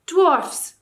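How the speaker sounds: high, loud call to the dwarfs; cried out. A narrator's reading. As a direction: call across the field to the dwarfs. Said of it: "cried Tyrion".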